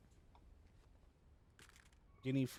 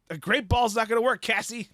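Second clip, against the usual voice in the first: higher, louder frantic yell; loud panicked call; 7 semitones higher, 9 dB louder than usual. Real, not acted. Panicked calling out in game.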